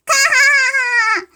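slow shouted evil cackle; evil villain cackle. Acted. Second thief villain laugh.

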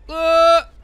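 sudden loud high yelp of fright; short panicked shriek; startled scream. Real, not acted. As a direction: let out a sharp scared scream. Scream as people fall.

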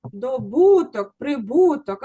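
"Добуток" very slow, stress on "До"; slow stress demo. Shown as a demonstration. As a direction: drag out "ДОбуток, ПРИбуток". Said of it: "Абсолютно правильно".

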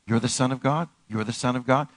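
ordinary talk, nothing marked

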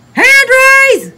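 high, loud call for a turn; eager loud call. Acted. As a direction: shout it out to get picked. Child calling out in play.

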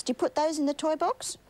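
ordinary delivery

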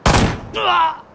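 loud cry of pain; pained yell. Acted. Villain beaten for the town, loud pained yell. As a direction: yell as the next blow lands.

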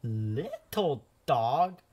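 slow, drawn-out wondering call; painter, slowed in surprise. Acted. Painter staring at the painted dog, slow wonder. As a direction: stretch it out, staring at the red dog. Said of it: "the painter said".

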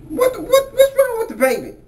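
high, quick alarmed question; suddenly worried about the baby; high worried what's wrong with the baby. Acted. Robber hears about the baby.

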